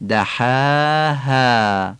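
دحاها very slow, a throaty ح and a light ها; Arabic ح and thin alifs. Shown as a demonstration. Teacher recites دحاها slowly for the class. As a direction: chant دحاها with a guttural ح.

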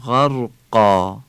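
غرقا said slowly with a plain Persian آ; Arabic long a. Shown as a demonstration. Teacher drawing out غرقا for Quran students.

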